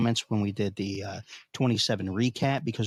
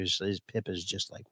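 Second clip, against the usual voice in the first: ordinary delivery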